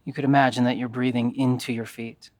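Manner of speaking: calm, even yoga nidra guidance with long pauses; guided meditation. Acted.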